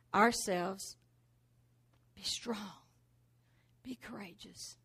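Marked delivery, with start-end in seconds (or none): 2.1-4.8 quiet self-encouragement. Acted. Saying it softly to yourself.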